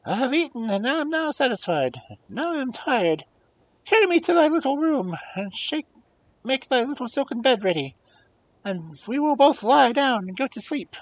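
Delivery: high, fast little frog voice; demanding frog asking for the golden plate. Acted. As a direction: squeak it out like a pushy frog.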